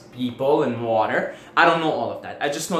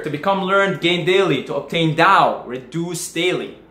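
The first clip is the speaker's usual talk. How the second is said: measured quote reading, a little louder, slowing at the end; 3 semitones higher, 4 dB louder than usual. Shown as a demonstration. Reading a Tao quote to viewers, measured and deliberate. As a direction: read the Tao line like a proverb. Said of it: "I'll read you a quote here".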